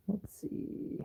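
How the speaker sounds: soft unvoiced whisper. Real, not acted. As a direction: whisper it to yourself. Whispering to himself.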